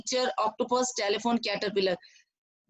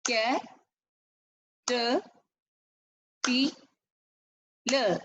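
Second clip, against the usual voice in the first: "Ca-, te-, pi-, la" in four separate beats with long pauses; syllable by syllable; slower than usual. Shown as a demonstration. Teacher clapping syllables for the class, "Ca-, te-, pi-, la" syllable by syllable. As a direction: break "Ca-, te-, pi-, la" apart, one syllable per clap. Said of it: "I clap the beat again".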